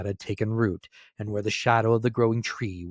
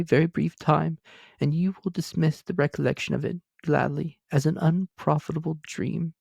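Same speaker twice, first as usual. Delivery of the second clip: much higher woman's voice; the girl releases young Scrooge, higher voice; grieving young woman; 7 semitones higher than usual. A narrator's reading. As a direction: voice the girl sorrowfully, pitched high. Girl's voice.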